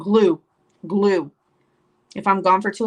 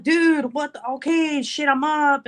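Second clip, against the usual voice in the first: exasperated; 6 semitones higher, slower than usual. Retold or imitated. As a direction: give in crossly to a pestering toddler. Woken mother snapping at toddler.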